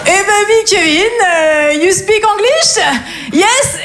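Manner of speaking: high, drawn-out pupil voice; élève lourdaud. Acted. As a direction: play a clueless pupil greeting foreigners. Imite Kevin face aux Polonais. (voix aiguë et traînante d'élève)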